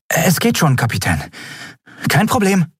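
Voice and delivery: put-on high-pitched young boy; boy's voice. A narrator's reading. Boy assures his captain he needs no help, high boyish voice. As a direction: pitch it high, like an eager lad.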